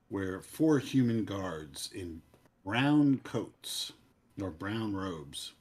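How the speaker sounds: ordinary talk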